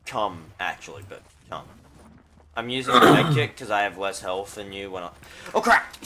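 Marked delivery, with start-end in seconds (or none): 5.5-5.9 sudden high yelp; startled yell. Real, not acted. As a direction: yelp in alarm. Sudden in-game panic.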